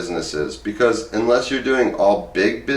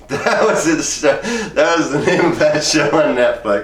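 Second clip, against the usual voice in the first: laughing recall; 5 semitones higher than usual. Real, not acted. Suddenly remembers forgotten show name.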